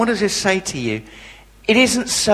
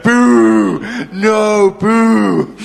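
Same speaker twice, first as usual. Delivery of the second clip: loud, higher mock booing; crowd booing; 3 semitones higher, 5 dB louder, much slower than usual. Kids jeer the unwanted speaker, loud mock booing. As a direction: jeer like a disappointed hall of kids.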